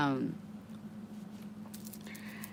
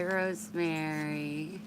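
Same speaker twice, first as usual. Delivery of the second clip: ordinary talk, nothing marked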